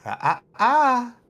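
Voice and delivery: high, loud tutting warning; chiding giant. Acted. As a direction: wag the finger with your voice. Giant scolds the squirrel.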